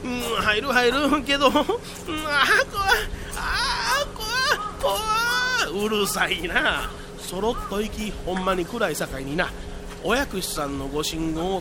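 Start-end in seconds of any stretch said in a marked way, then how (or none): 1.6-5.9 high whimpering fear; whimpering scared. Acted. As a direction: whimper in fright, pitched high. Scared entering dark cave.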